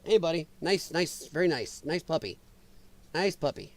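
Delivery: soft, low-key coaxing; soothing a puppy. Acted. Talking to someone's puppy, quiet and soothing.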